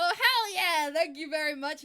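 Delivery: loud, high whoop for a new follower; excited cheer. Real, not acted. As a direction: throw your voice up in celebration.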